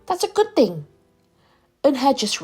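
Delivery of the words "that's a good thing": lightly voiced mother, higher pitch; mother's encouragement. A narrator's reading. Mother reassures Eunhae, encouraging. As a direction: lift the pitch to encourage a child.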